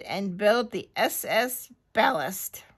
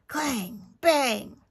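ordinary delivery, nothing marked

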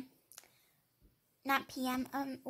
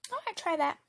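ordinary talk

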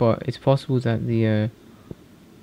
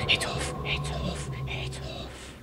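slow, hushed whisper; 10 dB quieter, slower than usual. Real, not acted. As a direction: breathe it out in fear. Nervous whisper in horror game.

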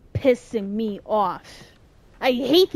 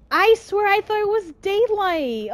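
frustrated protest; 8 semitones higher than usual. Real, not acted. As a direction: protest in disbelief. Insisting it was daylight.

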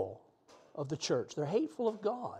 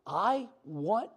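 ordinary talk, nothing marked

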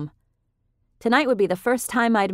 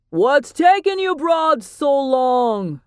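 slow, loud call from another room; man yelling from afar; 8 semitones higher, 6 dB louder, much slower than usual. A narrator's reading. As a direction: yell it across the apartment, drawn out. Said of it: "we heard a man's voice yell".

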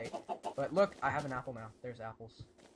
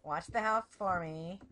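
mock-domestic sing-out, pitched up; playful husband act; 5 semitones higher than usual. Gamer pretends to leave spouse for wood, playful husband act. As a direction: play a doting spouse, barely serious. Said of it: "can't even say that with a straight face".

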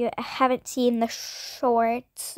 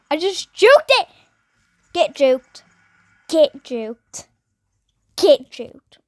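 loud triumphant trash talk; gloating; 10 dB louder, slower than usual. Real, not acted. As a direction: brag about the dodge. Bragging after dodging rat.

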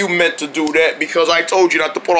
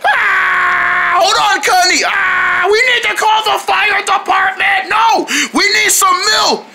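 high, panicked cries for help; frantic emergency; 11 semitones higher than usual. Acted. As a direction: holler urgently over the screaming. Frantic hunt for milk to help.

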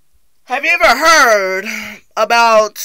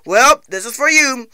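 ordinary delivery

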